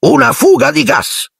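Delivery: very high-pitched grumbling driver. A narrator's reading. Ern answering Stan's question, grumbling character voice. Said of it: "brugnì Ernie".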